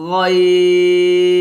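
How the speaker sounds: long, thick গইন from the end of the throat; heavy Arabic ghain. Shown as a demonstration. Teacher showing students how to say ghain heavily. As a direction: stretch গইন slow and heavy. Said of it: "মোটা করে উচ্চারণ করতে হবে".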